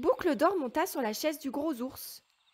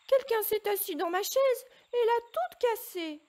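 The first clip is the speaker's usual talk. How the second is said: high, loud squeaky little bear cry; tiny bear's little voice; 10 semitones higher, 5 dB louder than usual. A narrator's reading. Little bear crying to his family over his eaten soup, high and loud. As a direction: wail it like a tiny bear cub. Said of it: "cria le tout petit ours de sa toute petite voix".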